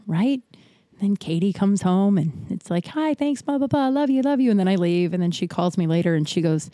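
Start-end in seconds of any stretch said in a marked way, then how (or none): 2.9-4.5 breezy goodbye. Retold or imitated. Breezy goodbye to parent, acted.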